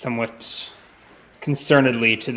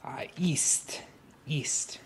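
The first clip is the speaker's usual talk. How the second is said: whispered; 3 semitones higher, 11 dB quieter, much slower than usual. Acted. Evasive character answers innkeeper.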